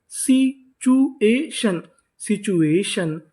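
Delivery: Situation stretched out very slowly, sound by sound; slow demo. Shown as a demonstration. Teacher slowly sounding out situation for the class. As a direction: spell out situation slowly for students. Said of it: "तो इतनी meaning क्या बनी?".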